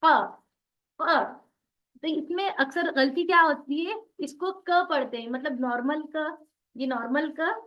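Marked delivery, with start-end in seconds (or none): 0.0-1.4 क said from the back of the tongue against the uvula; Arabic qaf from the uvula, deep and heavy; deep qaf from the root. Shown as a demonstration. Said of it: "उसका आखिरी हिस्सा उससे लगाइए".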